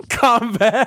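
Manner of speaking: cracking up mid-word; laughing. Real, not acted. Laughing while saying word.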